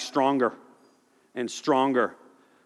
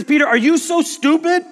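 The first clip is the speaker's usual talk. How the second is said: higher and louder, a mock rebuke; mock scolding shout; 12 semitones higher, 7 dB louder than usual. Jesus scolding Peter in a loud mock rebuke. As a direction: call him out at full volume. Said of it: "He says".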